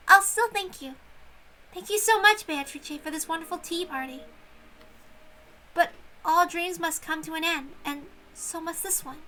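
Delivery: put-on young servant girl bidding farewell; grateful young maid. Shannon's voice in the story.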